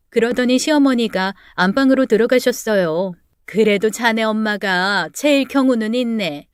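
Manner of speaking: ordinary delivery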